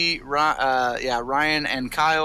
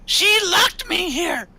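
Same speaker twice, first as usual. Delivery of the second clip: aggrieved little creature; 14 semitones higher than usual. Acted. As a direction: complain in a tiny, high creature voice. Gitmo complains about being locked in.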